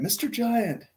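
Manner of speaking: high-pitched call without real volume; mock yell. A narrator's reading. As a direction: call up high to someone far above. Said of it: "So he yells at the giant".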